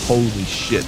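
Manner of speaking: ordinary talk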